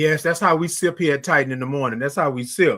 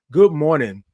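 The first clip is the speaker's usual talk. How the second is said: normal talking voice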